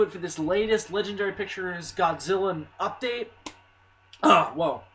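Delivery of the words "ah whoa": voice cracking. Real, not acted. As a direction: voice giving out, sick. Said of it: "losing my voice".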